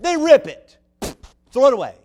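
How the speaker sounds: ordinary talk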